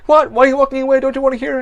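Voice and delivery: high, loud calling after someone; mock indignant shouting. Weirdo calling after her as she walks away, mock indignant shouting.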